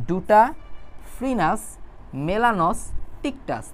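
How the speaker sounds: ডুটাফ্রিনাস মেলানোস্টিকটাস read very slowly, piece by piece; split into syllables. Shown as a demonstration. Tutor models slow chunked reading for students: slow syllable-by-syllable reading. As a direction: break ডুটাফ্রিনাস মেলানোস্টিকটাস into syllables and drag each one. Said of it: "ভাইয়া যেভাবে ভাগ করে বের করে দিচ্ছে, এভাবে পড়বা".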